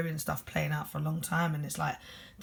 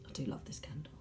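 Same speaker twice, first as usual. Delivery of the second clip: breathy whisper, much softer; whispered aside; 3 semitones lower, 14 dB quieter, faster than usual. Real, not acted. Reader admiring her candle in a soft whispered aside. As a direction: murmur it to yourself in passing.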